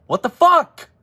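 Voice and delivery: high, loud cry of disbelief; disbelieving outburst. Real, not acted. Fan yelling at his team's failed two-point try, in disbelief. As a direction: yelp it out like you can't believe what you just saw.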